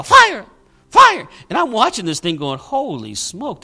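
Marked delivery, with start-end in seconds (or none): 0.0-1.3 very high, short yelping cry; high-pitched chihuahua yelp. Retold or imitated. Preacher imitates the shouting pastor down there, high chihuahua yelp. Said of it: "like a high pitch, and it sounded like a chihuahua". 2.6-3.6 slow, soft, stunned aside; hushed disbelief. Retold or imitated. Preacher watching this thing going on, hushed slow disbelief.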